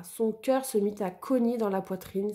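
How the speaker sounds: ordinary delivery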